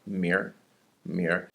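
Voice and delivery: 明儿 said softly with an r ending in place of tian; 明儿 as ming-r, quietly; Beijing erhua. Shown as a demonstration. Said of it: "replaced that tian with an 儿化音".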